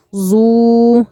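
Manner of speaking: زو with a long, level oo; elongated madd. Shown as a demonstration. Teacher demonstrating the next letter with waw, long held زو. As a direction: hold the vowel of زو. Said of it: "لازم نمد كده شوية".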